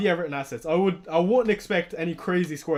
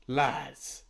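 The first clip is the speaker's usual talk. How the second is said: ordinary talk, nothing marked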